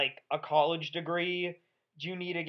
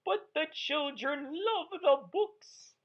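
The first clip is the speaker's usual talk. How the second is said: a higher, slow, sweet mock nun voice; imitating the nun; 7 semitones higher, slower than usual. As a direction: play a gentle pleading nun, high and slow. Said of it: "she goes".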